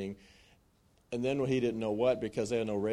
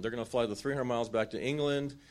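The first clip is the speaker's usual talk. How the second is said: ordinary delivery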